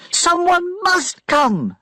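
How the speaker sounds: high slow shout; high drawn-out shout. A narrator's reading. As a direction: cry out for rescue. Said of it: "she shouted".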